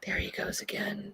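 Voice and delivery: whispered nature-film narration; hushed documentary whisper. Pretend. Voicing a wildlife film about her friend, whispered. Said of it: "can I do the voiceover?".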